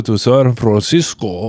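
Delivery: slow, exaggerated Spanish-style accent; mock someone slipping into a heavy accent; drawn-out, over-accented place name; sudden foreign accent. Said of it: "they suddenly have an accent".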